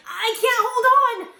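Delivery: high, loud, slow plea; desperate cry. A narrator's reading. Drowning boy calling to the princess. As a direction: cry out as if slipping.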